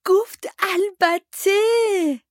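put-on high, loud, drawn-out child's yell; child's shout. A narrator's reading. Luke shouts the news from atop the stairs, yelling. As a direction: call it out slowly and high from far away. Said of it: "لوک از بالای پلکان داد زد".

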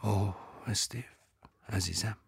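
soft whispered endearment; نجوا. A narrator's reading. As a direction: breathe his name softly. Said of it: "پس نجوا کرد". (نجوای عاشقانه و آرام)

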